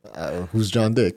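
low, slow, puzzled reply; quietly baffled, drawing it out; retold cluelessness. New worker admitting he has no idea who that was, quietly baffled.

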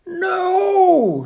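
high, loud drawn-out refusal; shouted. A narrator's reading. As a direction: shout it in protest. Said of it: "shouted Toad".

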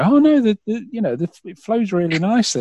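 high-pitched imitation of admiring listeners; mock praise. Listeners praising how the podcast flows, high mock praise.

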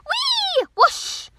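high whee into an airy whoosh; squeal, then blow out the whoosh; gleeful squeal. Acted. Sea animals play in the ocean, high gleeful squeal.

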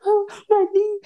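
high, loud mock wail; mock crying. Girl who grazed her knee, mimicked crying. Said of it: "She started crying".

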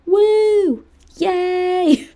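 high, squealing celebration cheer; excited cheer. Real, not acted. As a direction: cheer the milestone like a party. Celebrating the thirtieth show.

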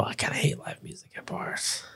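soft whispered confession; whispered aside. Real, not acted. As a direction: whisper it like a secret.

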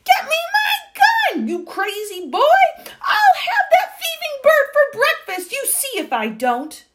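high, loud tirade; furious shouting. A narrator's reading. Duke threatening the thieving bird, furious shouting. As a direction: yell in a high-pitched rage. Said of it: "the Duke was shouting".

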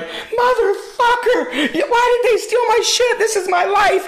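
high, drawn-out mock wail over stolen stuff; imitating a crying rant. Friend imitating his buddy's tearful meltdown, high mock wail. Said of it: "And you were crying".

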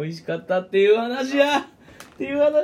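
mock exclaiming. Host mocking the short story.

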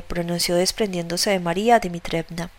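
ordinary delivery, nothing marked

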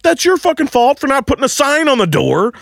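very high, heated retort; indignant arguing back. Retold or imitated. Man blaming coworkers for the missing sign, high indignant rant.

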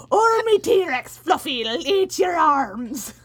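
loud, slow, high leprechaun growl; mean leprechaun. Retold or imitated. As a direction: threaten like a greedy leprechaun. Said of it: "leprechaun's more Irish and meaner".

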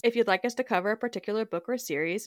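soft, steady podcast sign-off read; outro read. Acted.

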